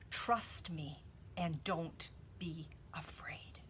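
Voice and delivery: slow, soft whisper, no voice at all. Shown as a demonstration. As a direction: breathe the words as a tender whisper.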